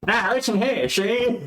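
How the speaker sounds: American mafia skeleton. Acted. Player voices the skeleton to the table, American mafia. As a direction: put on a hammy old gangster act. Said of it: "I put on my best skeleton voice".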